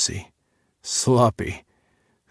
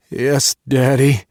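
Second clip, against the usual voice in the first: moaned; 3 semitones higher, 7 dB louder, slower than usual. Acted. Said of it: "I moaned".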